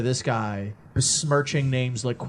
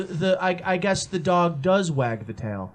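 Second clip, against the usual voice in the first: normal talking voice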